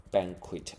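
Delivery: banquet stressed on "ban", final T sounded; stress on first syllable. Shown as a demonstration. Teacher shows students the difference, stress at the front. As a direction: hit the front of banquet, end on T. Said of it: "而且重音在前面不一样".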